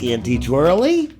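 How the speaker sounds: ordinary delivery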